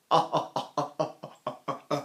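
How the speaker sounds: unvoiced inward gasp; silent gasp. Shown as a demonstration. Teacher showing the Mueller maneuver. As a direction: suck air in silently. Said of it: "if I go".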